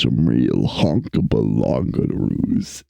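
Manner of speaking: very low, slow put-on voice; funny deep voice. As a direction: say it daftly in your deepest, drawn-out register. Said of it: "does some funny voices".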